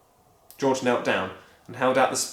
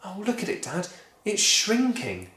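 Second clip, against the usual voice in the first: put-on mildly amazed boy; 8 semitones higher than usual. George watching the hen shrink, lightly amazed. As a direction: point it out amazed.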